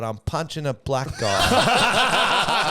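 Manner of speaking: slow mock South African accent, breaking into a laugh; comic South African accent, laughing through it. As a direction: deliver the punchline slowly in a put-on South African accent. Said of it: "Jeffrey's joke about South African accent".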